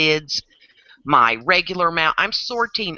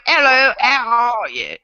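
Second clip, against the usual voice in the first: slow, higher mock British greeting; tight-mouthed British; 5 semitones higher, 3 dB louder than usual. Shown as a demonstration. Explaining British mouths to friends, pinched British accent. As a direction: pinch your teeth together for a British accent. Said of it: "pinch your teeth together".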